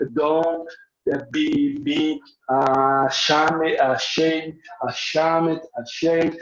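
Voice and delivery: slow, broken, stuck repeating ashamed, ashamed; halting, ashamed reading. Shown as a demonstration. Teacher demonstrating an over-careful learner, halting and stuck. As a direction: stammer over each syllable like a nervous reader. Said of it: "imagine se eu fosse ler aqui".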